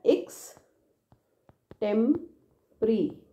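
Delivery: एक्सटेम्परी said very slowly, ending on a long ई; careful pronunciation. Shown as a demonstration. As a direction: stretch out एक्सटेम्परी and let the final ई ring. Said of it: "sound में भी आपको ध्यान रखना है". (एक्सटेम्परी को बहुत धीरे, आख़िरी ई खींचकर बोलें)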